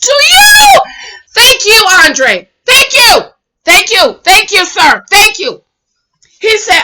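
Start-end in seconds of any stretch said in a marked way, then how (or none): 0.0-0.8 drawn-out, raised final words; mock emphatic retort. Retells Andre's blunt retort. 1.3-5.6 high, punchy repeated thanks; heartfelt agreement, each one hit hard; emphatic thanks. Real, not acted. Applauds Andre's retort emphatically.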